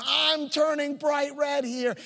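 high, slow, pressed alarmed complaint; alarmed protest. Retold or imitated. Sick student insisting to busy staff he's turning red, high and slow. Patient alarmed, ignored in hospital.

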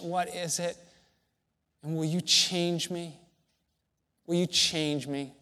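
soft, earnest prayer voice. Acted. A preacher relives praying to God in his car, soft and earnest. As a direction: pray quietly, pleading for change.

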